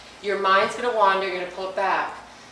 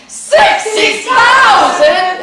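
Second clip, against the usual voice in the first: much higher and louder, slow drawn-out cheer; big excited shout; 10 semitones higher, 12 dB louder, much slower than usual. Shown as a demonstration. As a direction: shout it like a game show host. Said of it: "you gotta say it like that".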